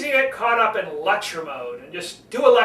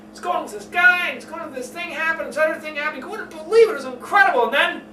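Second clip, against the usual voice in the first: much higher and louder, breathless excitement; excited storytelling; 8 semitones higher, 3 dB louder, faster than usual. Shown as a demonstration. Coach acting out an excited storyteller. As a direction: tell it like the wildest story ever. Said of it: "when you're telling stories".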